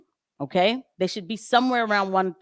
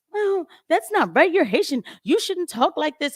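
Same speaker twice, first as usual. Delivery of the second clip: mocking scold; 9 semitones higher than usual. Mocking people who scold her for how she talks, in a high voice. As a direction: sneer it like a know-it-all critic. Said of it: "people will say".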